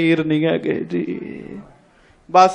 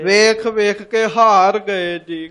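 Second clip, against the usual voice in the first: weary drawn-out telling; 4 dB louder, slower than usual. Acted. Preacher dramatizing weary searching.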